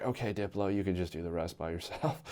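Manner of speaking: humiliated host quietly backing off; deflated retreat. Retold or imitated. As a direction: shrink away after reading mean comments. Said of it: "I just got red in the face".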